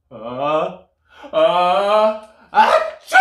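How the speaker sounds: loud sneeze building up. Acted. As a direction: wind up and sneeze big. Bear sneezes.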